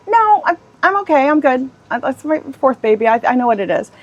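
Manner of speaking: high, breezy insistence she's fine; brushing it off. Retold or imitated. Pregnant agent assuring the caller she's fine, high and breezy. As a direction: wave off the worry, pitched well up. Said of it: "And I said".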